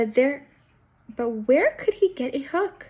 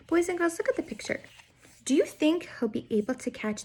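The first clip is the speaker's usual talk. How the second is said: put-on higher, bright call to kids; reading to children; 6 semitones higher, 3 dB quieter, faster than usual. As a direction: draw the children in.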